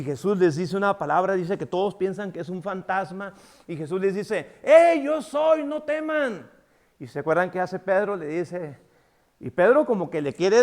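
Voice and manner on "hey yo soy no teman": slow, loud, high call across the water. Retold or imitated. Jesus calling to panicked disciples in the boat, loud and slow. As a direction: reassure frightened men over the storm.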